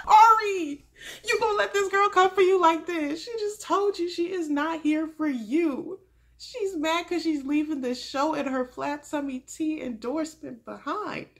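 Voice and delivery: very loud, high-pitched scolding with laughter; incredulous hollering. Pretend. Host scolding the lead on screen, amused hollering. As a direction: holler at him in amused disbelief.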